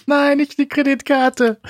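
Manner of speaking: high, slow, pleading; mock desperate plea. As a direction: drag out a horrified plea.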